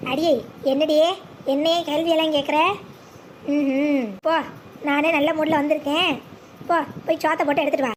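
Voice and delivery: low-pitched, tipsy and domineering; low boozy grumbling command; drunk husband. Acted. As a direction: order the wife about, half drunk. Drunk husband orders wife about.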